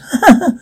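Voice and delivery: short light chuckle; acted chuckle. Queen responds to the mirror, chuckling. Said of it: "She chuckles".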